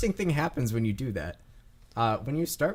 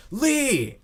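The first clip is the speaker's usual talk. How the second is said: high, loud battlefield scream; screamed name; 13 semitones higher, 6 dB louder than usual. Acted. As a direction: scream a comrade's name in one long cry. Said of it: "he screams".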